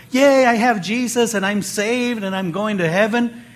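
high, bright mock cheer from a giddy new believer. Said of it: "they go around like".

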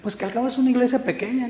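high, quick dismissive shrug; careless excuse. Retold or imitated. Lazy member excusing a small church, dismissive. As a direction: brush it off lazily. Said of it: "alguien dice".

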